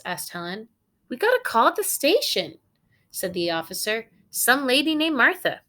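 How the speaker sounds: lively read-aloud for the class; story narration. A narrator's reading. Teacher reading a picture book to her students, animated storytelling.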